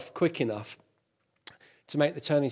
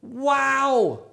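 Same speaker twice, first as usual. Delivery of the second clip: excited; 6 semitones higher, 6 dB louder, much slower than usual. Real, not acted. Drone lands back in hand.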